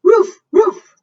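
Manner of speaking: high, loud bark for the dog. Acted. Dog barking from the roof.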